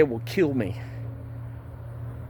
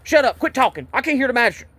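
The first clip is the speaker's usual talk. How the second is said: higher, clipped mock barks of shut up; mimicking a yelling cop; 7 semitones higher than usual. Man mimicking the cop who yelled at him to shut up, raised mock yell. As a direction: imitate the cop snapping orders over and over. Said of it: "Said the same thing at the magistrate's".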